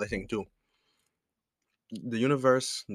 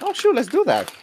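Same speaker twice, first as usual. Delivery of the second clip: high, louder mock excitement; a hammed-up burst of girlish excitement; excited retold quote; 8 dB louder than usual. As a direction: squeal it like a sudden fun idea. Retold excited gift idea.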